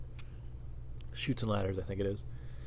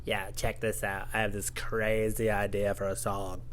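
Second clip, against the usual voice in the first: slow, softer put-on singer voice; imagined band singer; 4 semitones lower, 6 dB quieter, slower than usual. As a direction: pitch the idea in a slow, put-on drawl. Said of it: "when he first went to, like, his bandmates".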